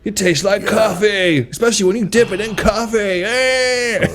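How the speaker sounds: high, eager bro barista. Retold or imitated. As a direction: sell it like a pushy bro. Bro barista voiced in bit.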